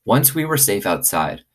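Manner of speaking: lively read-aloud for the class; story reading to children. Acted. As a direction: tell it like a bedtime story to kids. Reads chapter one aloud to class.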